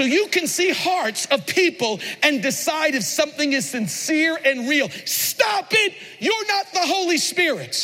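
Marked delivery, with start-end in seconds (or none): 5.1-7.7 preacher's rebuke. Real, not acted. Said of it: "rebuking congregation".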